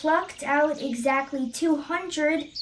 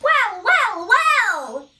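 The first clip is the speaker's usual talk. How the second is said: high, louder chirpy bird voice; chirping bird; 7 semitones higher, 6 dB louder, slower than usual. Acted. Zinazoo bird chirping at the antler nest, high and loud. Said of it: "he chirped".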